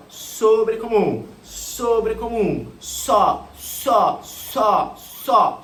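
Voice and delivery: SOBRECOMUM drawn out, then SÓ hit loud each time; chant-like drill, stretching "sobrecomum" and punching every "só"; slow emphatic repeats, each "só" a separate falling beat; memory drill. Shown as a demonstration. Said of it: "Pensa assim, ó".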